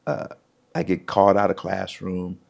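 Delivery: usual speaking voice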